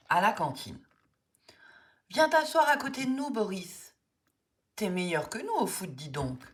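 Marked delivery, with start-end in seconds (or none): 2.1-4.0 calling out. Acted. Calling Boris over at canteen.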